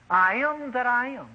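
slow, solemn proclamation of the divine name; voice of God. Retold or imitated. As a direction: declare it slowly like God from the bush. God answers Moses.

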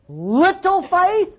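"little faith?" drawn out slowly, climbing high; "little faith?" stretched long and rising in disbelief; disbelieving question. Real, not acted. Incredulous repeat of Jesus' rebuke.

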